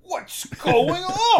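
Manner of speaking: slow, high, puzzled drawl; confused. Acted. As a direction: stretch it out, baffled. Acting Phil's confused line.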